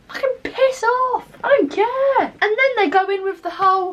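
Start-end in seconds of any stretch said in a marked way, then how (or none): 0.1-2.3 much higher, slow, fed up; exasperated dismissal. Retold or imitated. Telling off TikTok commenters, high slow fed-up dismissal. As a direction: drag it out and wave them off. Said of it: "I was like".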